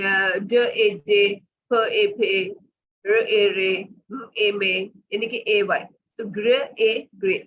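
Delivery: ड ए, then डे blended; phonics blending. Shown as a demonstration. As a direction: split each sound, then join it. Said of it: "जैसे". (ड ए डे, ध्वनि अलग फिर जोड़कर)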